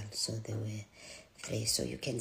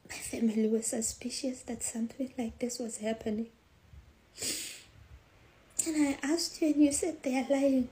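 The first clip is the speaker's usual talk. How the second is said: high, strained voice growing louder; distressed pleading; 10 semitones higher, 6 dB louder than usual. Retold or imitated. Mother recalling how she asked her daughter, increasingly distraught. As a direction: plead in rising anguish, voice climbing high. Retold confrontation, overcome with emotion.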